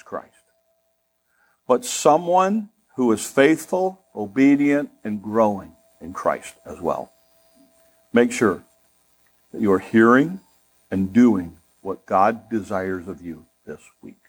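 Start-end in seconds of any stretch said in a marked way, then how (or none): none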